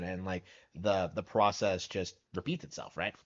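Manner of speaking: usual speaking voice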